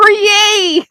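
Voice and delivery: squealed high yay, tongue in cheek; a jokey little high-pitched hooray; mock cheer. Real, not acted. Sewing host to viewers, mock-cheering her diagnoses: squealed yay.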